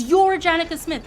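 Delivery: a touch of a thrilled worker; excited insisting. Retold or imitated. Worker pointing at homeowner, mildly excited.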